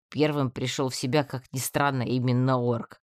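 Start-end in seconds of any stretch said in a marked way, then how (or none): none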